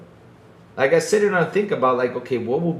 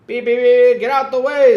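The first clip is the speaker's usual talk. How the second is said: higher, louder beeps and a yell; mock honking driver; 10 semitones higher, 9 dB louder than usual. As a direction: imitate an impatient driver. Imitates honking Dominican drivers.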